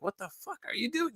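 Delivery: high, soft mock yell; retold dad's yell. Dad finding son by the pool, retold mock yell. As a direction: imitate an angry dad, high but held back. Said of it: "he was yelling at me".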